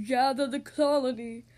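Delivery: booming, slow royal proclamation; heroic regal voice. Acted. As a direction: proclaim it like a mighty king. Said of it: "said in a heroic, regal voice".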